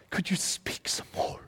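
put-on whispered, longing child's plea; mock pleading whisper. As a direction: breathe the question like an eager child. Pretend child asks in whisper.

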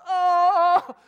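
loud high wailing "oh"; imitated dismayed cry. Mom as baby jerks awake, loud dismayed cry. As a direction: cry out as all is lost.

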